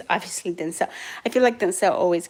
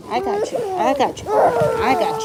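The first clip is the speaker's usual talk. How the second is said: high cooing reassurance; 13 semitones higher than usual. Real, not acted. Mother lifting her crying baby out, high and soothing.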